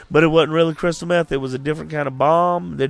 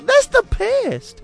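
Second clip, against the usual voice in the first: high squeaky voice; 3 dB louder, much slower than usual. Retold or imitated. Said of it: "I was like".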